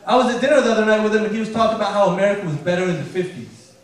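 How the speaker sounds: ordinary talk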